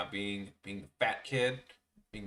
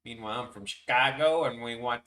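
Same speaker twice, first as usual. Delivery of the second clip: put-on Chicago accent; 2 semitones higher, 7 dB louder than usual. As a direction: play the loud Chicago guy. Mock Chicago sports-fan voice.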